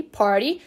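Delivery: "party" with T turned into a tapped R. Shown as a demonstration. Teacher showing how she pronounces it, T as R. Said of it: "eu pronuncio o T com som de R".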